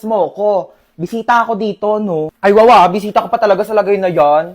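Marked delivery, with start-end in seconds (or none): 2.4-2.9 loud mock pity. Mocking pity in skit.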